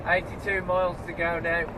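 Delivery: ordinary delivery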